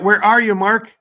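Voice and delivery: loud call across the house. Real, not acted. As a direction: call his name to someone out of sight. Host calls for absent guest.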